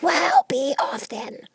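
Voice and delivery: very high, slow, hushed sulky bird; high slow sulky pigeon. A narrator's reading. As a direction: pigeon grumbles her away. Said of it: "said the pigeon in a sulky tone".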